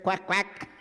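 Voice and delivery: the ducks quack back; imitated duck answer. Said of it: "They said".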